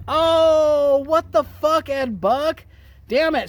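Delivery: loud, high burst of exasperation; exasperated outburst. Acted. Host rails at Ed Buck, exasperated. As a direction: cry out in disbelief, stretching the first word.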